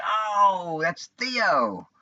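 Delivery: high, bright burst of recognition; surprised recognition. Retold or imitated. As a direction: suddenly realise who it is.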